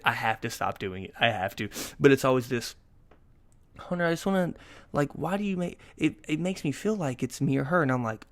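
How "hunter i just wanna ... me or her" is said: higher, lightly voiced complaint; girlfriend imitation. Girlfriend pleading with Hunter, imitated higher-pitched complaint. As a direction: imitate an upset partner, pitched up.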